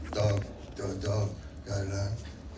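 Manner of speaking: humming the bass line dun dun; sung bass line. Shown as a demonstration. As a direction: sing the bass notes as syllables. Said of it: "the bass part was".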